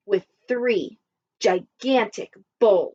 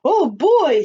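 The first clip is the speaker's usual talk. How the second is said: a big booming exclamation, higher and louder; 4 semitones higher, 6 dB louder than usual. A narrator's reading. Papa Dinosaur booms to his family, loud and booming. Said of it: "in his loud, booming voice".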